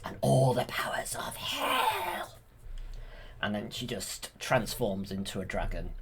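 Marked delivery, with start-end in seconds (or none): 0.0-2.4 villain whisper. Retold or imitated. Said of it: "And then she's like".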